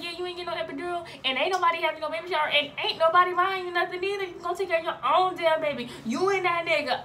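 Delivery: loud, high, rapid-fire rant; angry mom. Retold or imitated. Mom telling daughter she's on her own, angry. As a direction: tick off punishments in a mock tirade.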